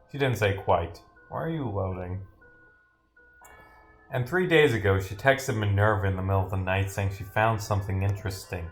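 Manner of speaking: ordinary talk, nothing marked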